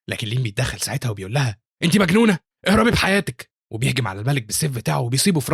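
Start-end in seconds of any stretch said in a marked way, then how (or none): 1.8-3.5 high, loud alarmed warning; urgent yell. A narrator's reading. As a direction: scold her into running for her life. Hero urges frozen girl to flee.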